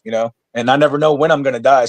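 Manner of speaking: ordinary talk, nothing marked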